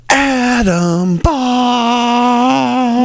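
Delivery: drawn-out announcer hype call; hype man intro. Acted. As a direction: stretch the name out like a ring announcer.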